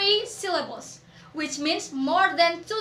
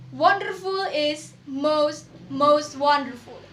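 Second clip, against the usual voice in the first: ordinary talk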